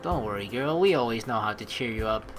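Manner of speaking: ordinary talk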